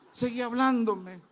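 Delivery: high, slow and loud, pressing him to keep talking; eager urging. Retold or imitated. Driver urges his passenger to keep talking, eager and loud. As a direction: keep me talking, you're hooked on the story.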